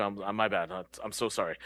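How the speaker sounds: ordinary talk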